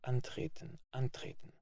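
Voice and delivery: whispered, dreamy murmur to oneself; as if asleep. A narrator's reading. A cadet murmuring to himself as in sleep, whispered. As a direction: mutter it half asleep. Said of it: "wie zu sich selbst, wie im Schlaf".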